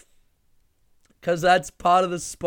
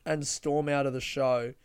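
ordinary talk, nothing marked

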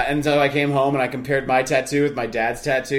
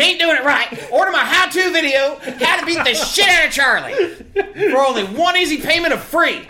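mock infomercial; 11 semitones higher than usual. Pitching a how-to video on beating Charlie, mock ad voice. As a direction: sell it like a cheesy TV ad.

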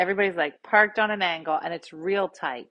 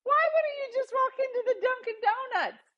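exasperated. Retold or imitated. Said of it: "I go".

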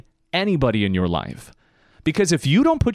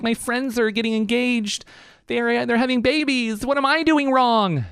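higher mock young woman's voice, climbing as she worries; 9 semitones higher than usual. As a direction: play the fretting young woman, rising higher.

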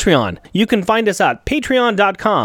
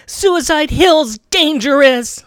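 slow and higher, drawn out; dramatic line read; 7 semitones higher, slower than usual. Shown as a demonstration. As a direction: deliver it with portent. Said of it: "Let me try that again".

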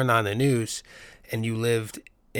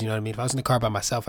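ordinary talk, nothing marked

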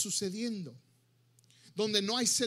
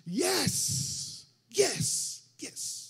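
high, triumphant yell; punchy victory cry; happy cheering; 5 semitones higher, 3 dB louder, much slower than usual. Acted. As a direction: celebrate like a goal went in. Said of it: "se ponen felices y gritan".

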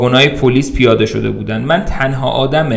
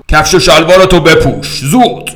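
loud, higher barked command; cop's order; 4 semitones higher, 6 dB louder than usual. Acted. Policeman orders the drunk to dress, loud and sharp. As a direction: bark it like a cop.

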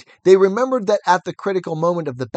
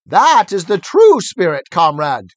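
loud approving cry; cried out; 8 dB louder than usual. A narrator's reading. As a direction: praise him loudly. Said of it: "cried Squealer".

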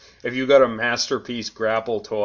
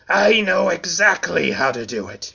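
sly villain, higher and louder; wicked; 6 semitones higher, 4 dB louder than usual. Acted. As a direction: say it with wicked glee. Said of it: "he added wickedly".

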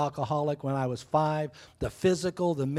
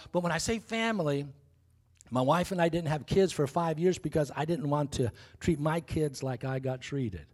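ordinary talk

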